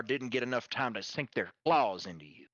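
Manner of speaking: ordinary talk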